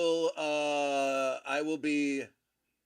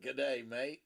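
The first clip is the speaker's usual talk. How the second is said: put-on a lightly done Australian accent, a bit softer; 3 semitones lower, 5 dB quieter than usual. Echoing his friend's Aussie greeting, in a light Australian accent. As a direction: toss off a casual Aussie greeting, nothing big. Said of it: "you sounded as really good accent".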